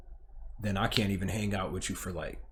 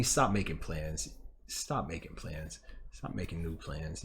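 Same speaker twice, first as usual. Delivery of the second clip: much lower and softer, telling herself off; quiet self-scolding; 7 semitones lower, 10 dB quieter than usual. Real, not acted. Scolding herself mid-vlog for making plans, quiet. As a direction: mutter a reminder to yourself.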